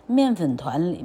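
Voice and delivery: usual speaking voice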